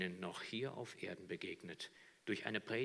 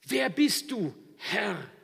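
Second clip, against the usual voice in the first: loud, higher awed stammer; stammering Saul; 11 semitones higher, 12 dB louder, slower than usual. Acted. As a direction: falter out the question. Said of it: "er stammelt". (stammelnd, ehrfürchtig)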